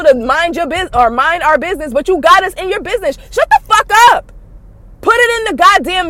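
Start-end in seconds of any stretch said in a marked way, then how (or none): 3.3-6.1 raised, high-pitched furious command; loud fed-up outburst; angry yell. Real, not acted. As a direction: shout her down. Angry rant, telling her off.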